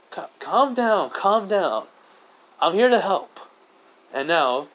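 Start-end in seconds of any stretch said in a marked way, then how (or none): 0.1-3.4 raised, higher-pitched reassurance; urgent but controlled appeal; calling for calm. Acted. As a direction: firmly settle a panicked crowd over the noise. Naruto calming the panicking goblins.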